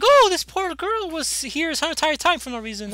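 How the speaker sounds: high, slow, put-on pitying voice; mock pity. Retelling Talia on the geek's daughter, mock pity.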